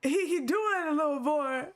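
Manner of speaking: barely holding it together with laughter; laughing. Real, not acted. Cracking up at the voice acting.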